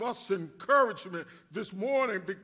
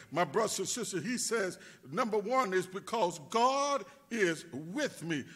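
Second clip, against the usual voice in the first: usual speaking voice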